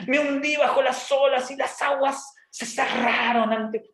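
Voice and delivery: high, slow, overblown declamation; hammed-up sacred pomp, every word drawn out; Jonah's pompous prayer. Jonah praying from the depths, grandly pompous. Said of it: "Yo me imagino toda la pompa".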